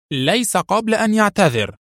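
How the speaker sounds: high-pitched, cutting demand; sharp, stern. A narrator's reading. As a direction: insist sharply, brooking no argument. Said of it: "وقالت في حدة صارمة".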